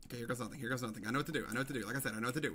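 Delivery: low, hushed murmur; nervous self-talk. Real, not acted. Player psyching himself up to jump, quiet nervous muttering. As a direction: talk yourself into it under your breath.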